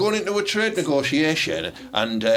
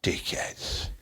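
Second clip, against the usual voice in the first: whispered insult; 9 semitones lower, 9 dB quieter than usual. Real, not acted. Muttered insult aside.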